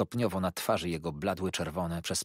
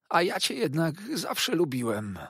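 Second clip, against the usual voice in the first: much higher, sugary false affection; wheedling toady; 10 semitones higher, 4 dB louder than usual. A narrator's reading. Uriah tells Copperfield he always liked him, high and wheedling. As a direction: coo it like a hurt flatterer.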